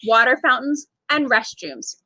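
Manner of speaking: ordinary delivery, nothing marked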